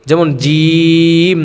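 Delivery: জিম held long and slow; drawn-out Arabic letter. Shown as a demonstration. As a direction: stretch জিম like a Quran reciter. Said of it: "আরবি কোনো হরফকে". (টেনে টেনে জিম)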